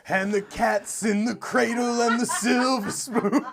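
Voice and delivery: loud laughing lyric line. Real, not acted.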